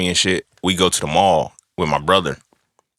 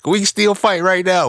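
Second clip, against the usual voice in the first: high-pitched mock-indignant comeback; exasperated squeal, playing it for laughs; acted retort; 11 semitones higher than usual. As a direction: protest way up in the voice. Acts out snapping back at teasing.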